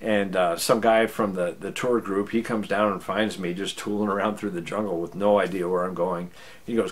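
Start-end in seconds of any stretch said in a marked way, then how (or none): none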